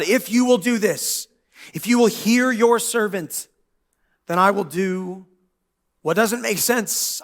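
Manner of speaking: slow, deliberate vow to God; slow bargaining. Retold or imitated. Hannah bargains earnestly with God, slowly. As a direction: lay out the deal word by word. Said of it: "she bargains with God".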